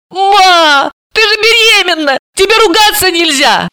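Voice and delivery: very high, tearful whimper; high tearful girl's whine; whining tearfully. Acted. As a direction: plead tearfully with mother. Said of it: "она плаксиво промямлила".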